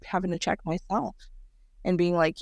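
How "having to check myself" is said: ordinary talk, nothing marked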